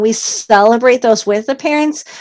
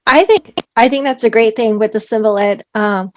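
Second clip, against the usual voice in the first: usual speaking voice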